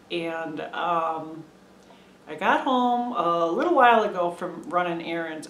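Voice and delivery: normal talking voice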